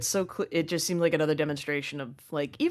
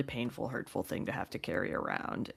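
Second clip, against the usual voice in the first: drops to a near whisper, much lower; hushed; 5 semitones lower, 9 dB quieter than usual. Real, not acted. Host describing a painful feeling, near whisper. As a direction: breathe it out softly.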